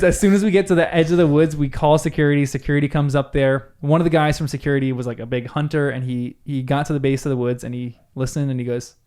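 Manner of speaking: ordinary delivery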